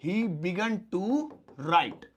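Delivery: very slow, drawn out teaching read; slow dictation. Shown as a demonstration. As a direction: spell it out slowly for the class. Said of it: "तो कैसे बोलेंगे".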